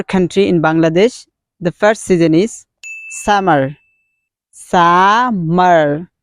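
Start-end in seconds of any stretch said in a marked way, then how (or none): 3.2-3.7 "summer" with a rounded American R at the end; American pronunciation. Shown as a demonstration. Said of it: "pronounce with American accent". 4.5-6.1 "Summer" drawn out in two parts, SUM... MER; syllable by syllable. Shown as a demonstration. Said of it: "You should pronounce".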